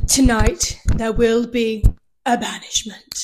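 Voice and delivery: slow, grave announcement; dramatic host voice. Retold or imitated. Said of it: "she's like".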